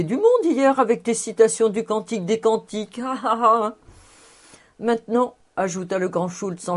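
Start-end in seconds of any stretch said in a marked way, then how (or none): 3.0-3.7 a short, spelled-out ha ha ha laugh; mock laughter. A narrator's reading. Anne teasing Fritz about his quotes, mock laughing.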